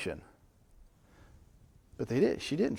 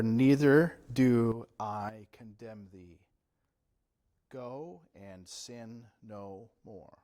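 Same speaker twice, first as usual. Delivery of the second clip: gentle Jesus voice; 4 semitones lower, much slower than usual. Acted. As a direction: lay it down gently like a verdict of mercy. Jesus speaking to the forgiven woman.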